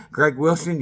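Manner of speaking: ordinary delivery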